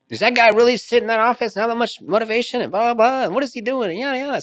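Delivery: very high mock female voice; mimicking a woman. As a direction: imitate her in squeaky falsetto chatter. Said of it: "tough as nails type chick, and she's like".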